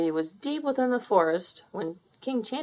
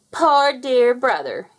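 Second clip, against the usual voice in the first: slow, high, loud sing-song sneer; twittering mockery; 5 semitones higher, 6 dB louder, slower than usual. Fowler mocks Henry at the gates, slow, high and loud. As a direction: drag out the fake pity. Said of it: "he twittered".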